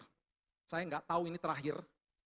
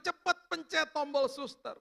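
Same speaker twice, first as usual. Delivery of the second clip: high, loud, drawn-out urging; urgent retold cry; 9 semitones higher, 6 dB louder, slower than usual. Wife to husband as the heat climbs, urgent cry. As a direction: imitate a wife calling out for help.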